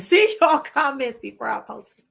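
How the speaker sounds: ordinary delivery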